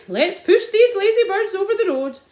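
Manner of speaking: high, loud cheerful call; rallying cry. A narrator's reading. Sergeant urging the men to push birds, loud. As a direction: urge everyone on. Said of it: "he cried".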